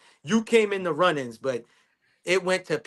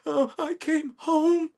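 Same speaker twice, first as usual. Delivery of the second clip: much higher, slow, breathless mock-dramatic voice; dramatic friend; 12 semitones higher, 4 dB louder, slower than usual. Teasing his friend's phone call, high melodramatic mock voice. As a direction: imitate her breathless bad-news opening, laughing. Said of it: "I heard your vo".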